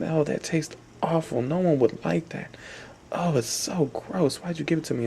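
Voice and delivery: high, grossed-out complaint after a mouthful; disgusted. Acted. Deaf kid complaining to the nerd after spitting, disgusted. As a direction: pull a face and gag on the words. Spits out awful drink, coughing.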